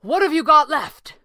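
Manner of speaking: high, loud shout; yelled question. A narrator's reading. Red panda asking the sorcerer in battle, yelled question. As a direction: shout it across the racket. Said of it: "yelled to be heard over".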